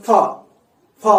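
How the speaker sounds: ఫ with air pushed out hard; forceful breathy release. Shown as a demonstration. Teacher explains why it's a fricative, forceful release of air. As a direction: blow out each ఫ separately. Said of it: "బయటికి నెట్టివేయబడుతుంది చాలా force గా".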